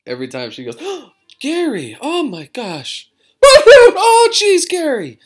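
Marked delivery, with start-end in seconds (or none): 0.8-5.2 high, loud, laughing startled cry; startled mom. Retold or imitated. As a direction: jolt from a scare then laugh. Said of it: "it scares my mom".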